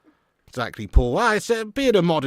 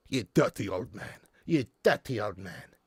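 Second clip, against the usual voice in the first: sitcom catchphrase impression; 5 semitones lower, 5 dB quieter than usual.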